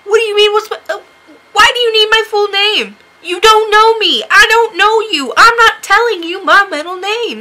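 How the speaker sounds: high, loud protesting rant; indignant mock outburst. Acted refusal to give name.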